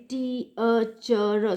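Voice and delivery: "T, a, ch, r" drawn out as four separate syllables. Shown as a demonstration. Lecturer demonstrating a wrong division, slowly in four syllables. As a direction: slowly break "T, a, ch, r" into four beats. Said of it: "pronounce the word according to the four syllables".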